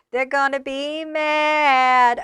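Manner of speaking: slow, sing-song they're gonna be mad; slow gloating drawl. Acted. Repeats the line drawn out.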